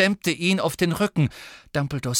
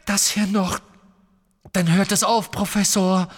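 high, louder, desperate promise; voice breaking; 7 semitones higher, 4 dB louder than usual. Acted. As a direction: coax the professor with a cracking voice. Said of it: "seine Stimme brach". (mit brechender, verzweifelter Stimme)

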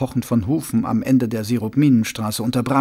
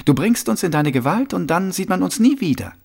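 lightly voiced accuser, pitched higher; a higher voice with mild distrust; suspicious young man; 7 semitones higher than usual. Young man accuses the watchman of a trick, mildly suspicious.

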